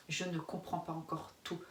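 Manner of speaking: ordinary talk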